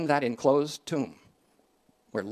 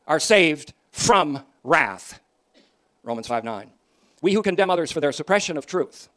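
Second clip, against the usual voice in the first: louder pulpit voice, slowing on key words; fiery preaching; 2 semitones higher, 7 dB louder than usual. Acted. Preacher contrasting false and true gospel, fiery preaching. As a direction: drive it home, drawing out saved from wrath.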